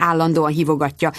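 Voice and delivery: plain reading voice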